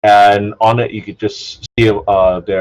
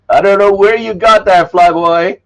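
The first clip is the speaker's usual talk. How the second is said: lifted, louder voice for the amused bartender; 10 semitones higher, 5 dB louder than usual. Acted. Bartender teasing the player character, in a higher amused voice. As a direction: play her teasing him, head shaking. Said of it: "she shakes her head and she says".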